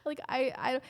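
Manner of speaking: high-pitched, repeated pleading; mock frantic apology. Girlfriend insisting it's not her fault, retold high-pitched pleading. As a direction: plead it high, stumbling over the words.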